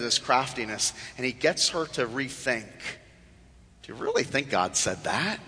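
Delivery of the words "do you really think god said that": low, softened mocking question; sly tempter's hushed mockery; mocking serpent. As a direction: quietly taunt with doubt. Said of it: "He's basically mocking her".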